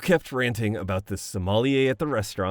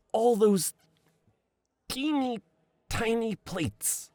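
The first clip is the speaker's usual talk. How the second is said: much higher, mincing and slow on the tiny plates; mock dainty voice; 14 semitones higher than usual. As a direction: squeak it out like something ridiculously precious. Mocking the tiny fancy plates.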